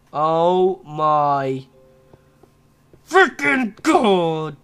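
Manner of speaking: slow exasperated build into a loud yell; annoyed outburst. Real, not acted. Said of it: "I am so annoyed right now".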